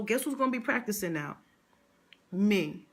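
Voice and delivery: ordinary talk, nothing marked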